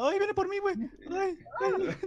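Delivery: high panicked screams; being chased. Real, not acted. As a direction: shriek in sudden terror. Monster coming for him.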